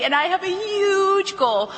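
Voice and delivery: "huge" stretched out high; stress on huge. Shown as a demonstration. Excited demo of sales script.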